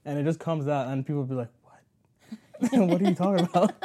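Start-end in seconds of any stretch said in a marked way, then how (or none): 1.6-3.7 high, loud, laughing mock confusion; laughing confused friend. Friend mocking her slip, laughing and confused. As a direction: laugh through a puzzled friend's question.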